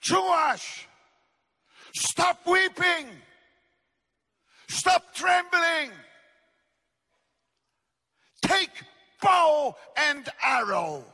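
commanding shout. Acted. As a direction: shout down his trembling. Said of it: "prophet commands Joash".

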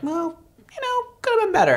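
dramatic high-pitched letdown; mock disappointed customer. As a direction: squeak it out like an unimpressed fan. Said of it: "you guys try it and you're like".

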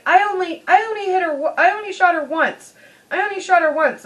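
put-on high, whiny kid protesting; whiny son. Son pleading with his dad, high and whiny. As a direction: plead like a guilty little boy, voice up high. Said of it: "the son was like".

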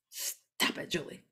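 hushed, sinister evil twin. Acted. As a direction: scold yourself as your wicked twin. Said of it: "talking like my evil twin today".

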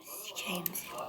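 hushed, breathy swear under the breath; whispered curse. Real, not acted. Muttered curse at the game.

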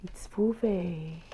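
breathless whisper of delight; awed whisper. Real, not acted. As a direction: whisper the name in awe. Awed reveal of bottle.

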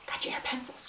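voiceless whisper. Shown as a demonstration. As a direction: hush it like a secret. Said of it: "whisper check with class".

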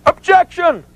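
very high-pitched yell; yelled courtroom objection. Retold or imitated. Opposing counsel cutting off the witness, yelling. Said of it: "opposing counsel yells objection".

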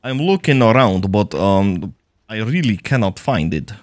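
put-on Russian accent while gaming. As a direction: play the comrade with a hammy Russian accent. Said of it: "Russian accent, comrade".

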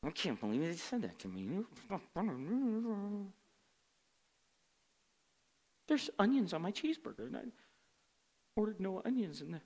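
mock grumbling. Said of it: "it's undertones, that's grumbling".

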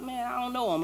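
mock a mildly nervous denial; fearful excuse. Peter trying to get out of trouble, mildly fearful. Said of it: "he was in fear".

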